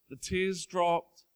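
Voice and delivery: ordinary talk, nothing marked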